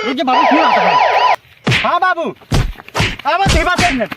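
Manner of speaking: high, loud panic; panicked shout. Acted. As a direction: yell in a panic. Panic as police come.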